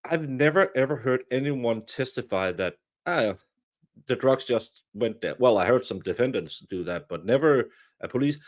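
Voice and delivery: ordinary talk, nothing marked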